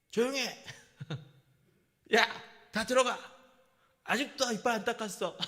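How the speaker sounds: mock angry yelling. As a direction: imitate yourself snapping at the kids to go. Pastor acting his own angry yelling.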